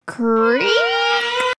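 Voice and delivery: long, drawn-out creak climbing high; rising creak. Acted. Scary movie noise for young readers, high drawn-out creak. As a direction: stretch it up like an old hinge. Imitated movie sound effect.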